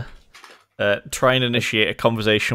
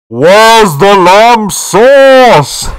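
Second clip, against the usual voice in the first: booming, very slow, far higher and louder; magically amplified voice; 12 semitones higher, 14 dB louder, much slower than usual. Acted. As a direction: bellow it like a thunderous spell. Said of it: "my voice booms up to three times as loud".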